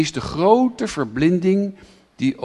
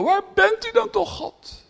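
high pleading cry that fades to a breath; crying out to God. Retold or imitated. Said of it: "En het Joodse volk maar schreeuwen".

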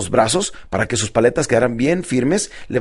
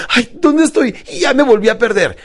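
mock alarm; 8 semitones higher, 3 dB louder than usual. Voicing a lost, forgetful client.

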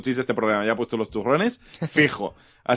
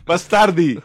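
put-on Italian accent; slower than usual. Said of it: "yo digo bastardi".

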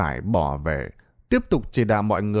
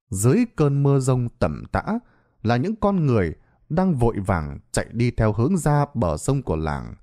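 ordinary delivery

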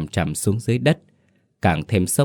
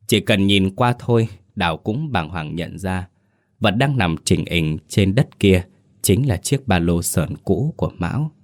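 ordinary delivery

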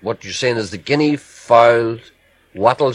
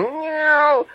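high mock meow; cat meow. Host joking that the fox meows, high mock meow. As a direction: squeal out a silly cat meow. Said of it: "the fox is going".

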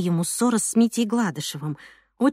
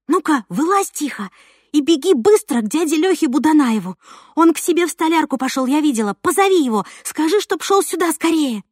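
put-on urgent girl voice; 6 semitones higher, 3 dB louder than usual. Girl urging friend.

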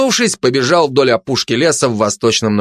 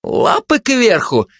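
high, drawn-out interjection; high-pitched protesting interruption; interrupting; 11 semitones higher, slower than usual. Acted. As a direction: cut in with a high cry. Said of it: "перебил предатель".

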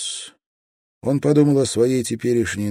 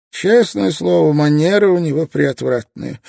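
loud, high-pitched haughty complaint; supercilious, loudly offended remark; haughty disdain; 10 semitones higher, 7 dB louder than usual. A narrator's reading. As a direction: sneer from on high, voice raised. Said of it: "высокомерно поморщившись".

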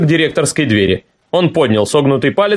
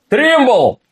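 shout; 8 semitones higher than usual. A narrator's reading. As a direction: bellow the name across the office. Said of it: "закричал директор".

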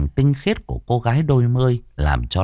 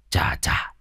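hot puff; 6 dB quieter than usual. Acted. Fanning himself in heat.

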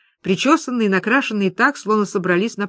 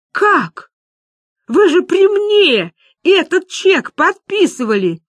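high, loud, anguished protest; outraged disbelief; 10 semitones higher, 6 dB louder, slower than usual. Acted. Люба protests that he signed it, outraged. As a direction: cry out slowly, stretching the words.

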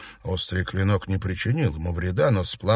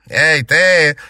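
loud, higher call; shout; 6 semitones higher, 8 dB louder than usual. A narrator's reading. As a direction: yell to grab her. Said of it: "крикнул Бест Феофании".

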